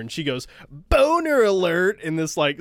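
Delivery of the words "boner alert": high, slow, loud imitation of his girlfriend. Imitating his girlfriend's silly, high, slow boner alert. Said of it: "in that voice".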